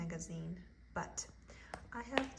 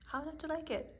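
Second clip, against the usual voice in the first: much higher pitch; 8 semitones higher than usual. Acted. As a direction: repeat it pitched well up, a delighted rhetorical question. Repeats question, second time higher.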